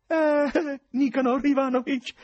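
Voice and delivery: esclamazione acuta e forte, cordiale; voce cordiale. Acted. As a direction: saluta come un vecchio amico, molto più acuto. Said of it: "esclamò lo sconosciuto con voce cordiale". (lo sconosciuto esclama il nome con cordialità, acuto e forte)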